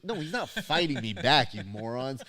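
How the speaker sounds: retold exasperated retort, jumping up at the start; mock exasperation. Coach correcting friends who got it wrong, mock exasperated. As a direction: set them straight with playful impatience.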